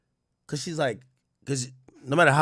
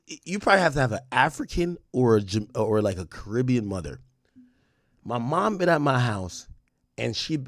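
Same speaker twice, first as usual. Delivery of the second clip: ordinary talk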